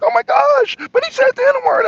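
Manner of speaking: mock shocked Miss Lynn. Mocking Miss Lynn's outrage over the word, high-pitched. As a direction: mimic her gasping outrage, high and loud. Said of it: "And she's like".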